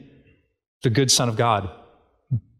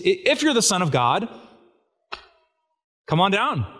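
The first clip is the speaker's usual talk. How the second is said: lightly mocking taunt, higher and partly slower; mocking voices; 5 semitones higher than usual. Enemies taunting Jesus on the cross, lightly mocking. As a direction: sneer up at the man on the cross. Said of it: "Do you remember their mocking voices?".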